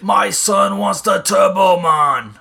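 loud, drawn-out Schwarzenegger impression with a terrible accent; over-the-top, badly done Arnold accent shout. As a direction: bellow it slowly in a hammed-up Arnold accent. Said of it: "is that the worst accent in the world".

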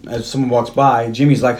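usual speaking voice

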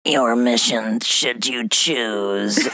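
hushed, very drawn-out villain imitation; villain impression attempt. Friend takes a turn at the villain, fighting giggles, slow mock menace. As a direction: stretch it out slowly, half-whispered. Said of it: "Let me try".

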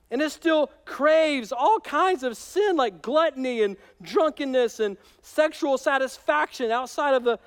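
high, loud, pleading voice of a torn Paul; voicing Paul. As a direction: play Paul wrestling with his flesh. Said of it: "he goes".

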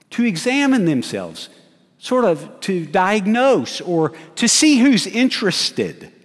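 rising sermon intensity; full-force preaching. Acted. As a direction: drive the point home from the pulpit.

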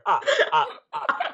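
vampire counting laugh "ah ah ah". Pretend. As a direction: cackle like the Count.

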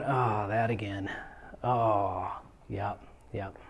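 very soft, slow, whispery sigh; low, resigned, barely voiced groan of recognition; weary mutter to self. Preacher mimics facing old baggage creeping back, weary whispered mutter. Mimics reaction to nagging past.

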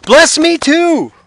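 high, loud, drawn-out begging; loud bitter cry. A narrator's reading. Esau begging his father for a blessing, loud bitter cry. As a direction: plead at full voice, stretching the words. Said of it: "he let out a loud and bitter cry".

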